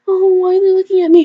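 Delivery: high, fast, flustered mock voice; high mock panic, rushed; mock nervous guest. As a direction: squeak it out like a panicked guest at a wedding. Mimicking self as nervous guest.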